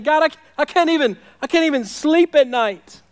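high, loud plea; crying out. Acted. Preacher voicing sleepless David to God, crying out. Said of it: "he's crying out to God".

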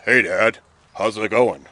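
low husky voice, posing as his brother; husky Esau imitation. Jacob pretending to Esau to his dad, low and husky. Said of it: "He speaks in a husky voice".

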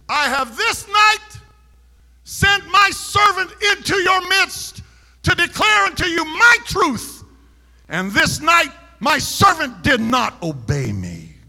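high, slow, shouted voice of prophecy; prophetic utterance. Retold or imitated. Interpretation in tongues rebuking the preacher, thundering. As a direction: thunder like God rebuking his servant.

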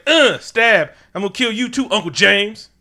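high, loud hammed-up threat; mock cartoon killer yelling at his uncle; mock villain yell.

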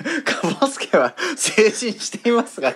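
teasing quote. Retold or imitated. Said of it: "自分で言う？".

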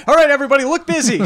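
high, loud, flustered cover-up; panicked mock innocence. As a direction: shout it like you've been caught red-handed. Foreigner caught, acting innocent.

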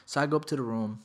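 usual speaking voice

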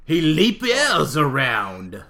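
bad, slow French accent; slow mock French accent. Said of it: "my terrible French impression".

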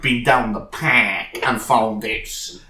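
slow, lazy put-on Cardiff accent; lightly hammed-up Ely accent; mock broad Cardiff accent. Mocking how he writes, in a slow Cardiff accent. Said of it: "He's always like".

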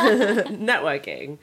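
very slow laughing drawl. Acted. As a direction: stretch it out while laughing. Drawn-out word with a laugh.

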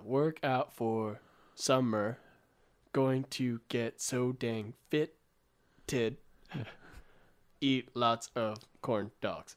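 very slow poetic recital; haiku recital. Acted. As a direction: stretch each word like verse. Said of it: "a live haiku composed on the spot".

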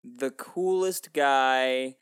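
slow, drawn-out mock gravity; mock dramatic. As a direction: savor every word slowly. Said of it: "Ladies and gentlemen of the jury".

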